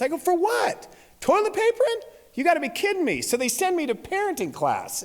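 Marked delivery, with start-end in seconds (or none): 0.2-3.2 high, incredulous protest; outraged disbelief. Retold or imitated. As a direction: act out a dad who can't believe the charge. Acted outrage at the punishment.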